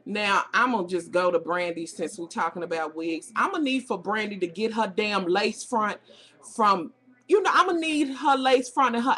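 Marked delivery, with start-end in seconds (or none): none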